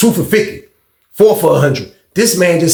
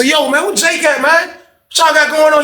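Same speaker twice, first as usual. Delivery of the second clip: high-pitched retold badgering; impatient questioning; 11 semitones higher than usual. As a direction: imitate the impatient crew, voice raised high. Waiting men badger the cellmate.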